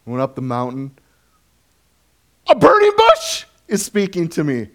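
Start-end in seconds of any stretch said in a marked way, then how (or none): 2.5-4.7 high, loud burst of disbelief, then settling; played-up amazement; incredulous Moses. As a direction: blurt it in astonishment, then come down in pitch. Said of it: "what Moses must have thought".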